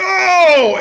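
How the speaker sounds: mock commentator yelping "Oh!" high and loud; hammed-up "Oh!" to mock the big-move call. Mocking wrestling commentators with an over-the-top yelped "Oh!". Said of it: "accentuating big moves with going".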